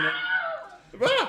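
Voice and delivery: very high, laughing cry of alarm; strangled yelp. Acted. Co-host's mock cry of Zack Snyder, high laughing yelp. That'd be the sound.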